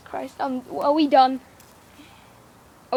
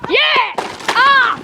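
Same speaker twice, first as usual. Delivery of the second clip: excited shouting; 7 semitones higher, slower than usual. Real, not acted. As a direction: yell out in wild surprise. Reaction to crash.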